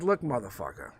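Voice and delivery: low-pitched mock confrontation; mock tough. Reader answering the cocky guy, mock tough. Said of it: "I didn't say it like that".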